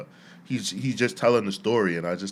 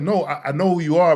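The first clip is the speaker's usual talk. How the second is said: higher, louder, caught-off-guard greeting; nervous and flustered; 8 semitones higher, 8 dB louder than usual. Retold or imitated. Startled employee greeting the stern boss, flustered. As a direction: blurt it out flustered, like meeting your father. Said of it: "nervous and flustered".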